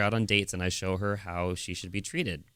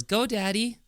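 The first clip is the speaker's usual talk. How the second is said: put-on high cheering child's voice; little girl cheer; 12 semitones higher, slower than usual. Daughter handing dad the ball, high cheer. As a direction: cheer on daddy sweetly. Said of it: "and she says".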